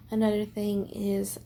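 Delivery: ordinary delivery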